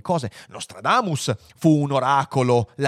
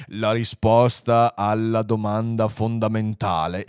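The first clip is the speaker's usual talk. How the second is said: slow, portentous announcement; solemn computer; 3 semitones lower, slower than usual. Acted. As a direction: draw out the build-up ponderously. Computer solemnly builds suspense. (annuncio lento e solenne)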